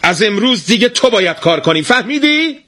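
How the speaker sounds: a wife laying down the new rule. A narrator's reading. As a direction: raise your voice and order him. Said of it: "بعد صداشو بلندتر کرد".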